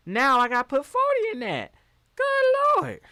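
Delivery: Good Lord shot way up high and loud; exasperated. Real, not acted. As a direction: throw up your hands at the pump price. Exasperated about gas prices.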